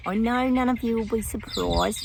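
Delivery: ordinary talk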